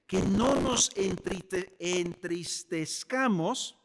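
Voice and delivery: slow, careful syllable-by-syllable "entristezcamos"; careful tongue-twister. Real, not acted. Preacher slowly working through a tricky word "entristezcamos". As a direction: stumble then slowly spell out "entristezcamos". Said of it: "parece un poquito trabalengua".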